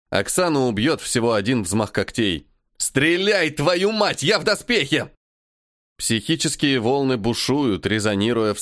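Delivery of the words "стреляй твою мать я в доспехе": shouting orders over battle. Acted. Hero yells order mid-fight.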